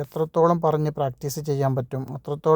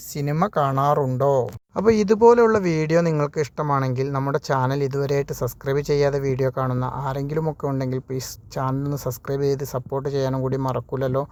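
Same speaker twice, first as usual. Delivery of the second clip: normal talking voice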